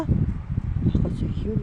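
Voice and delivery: slow, breathy hushed aside; whisper. Real, not acted. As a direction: whisper it so the caller won't hear.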